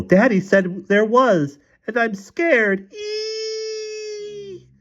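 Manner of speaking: very high, slow frightened wail; squealing. A narrator's reading. Scared mother telling her daughters of the spider, squealing. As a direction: squeal it slowly, ending in a thin long shriek. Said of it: "continued to squeal".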